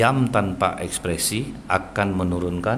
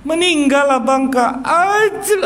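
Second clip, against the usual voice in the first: very high, slow mock widow's wail; hammed-up grieving wail; 5 dB louder, slower than usual. Widow tells mourners her husband died, hammed-up wailing. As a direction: wail the news as if about to faint. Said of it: "ah, pingsan langsung".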